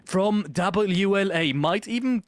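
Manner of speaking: tense, building play-by-play; esports caster. Real, not acted. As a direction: keep the tension climbing. Live play-by-play.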